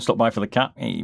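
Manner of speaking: normal talking voice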